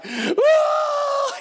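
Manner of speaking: very high comic wail; mock bawling wail. Overwhelmed by free groceries and gas, hammed-up bawling. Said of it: "You know, I'm bawling now".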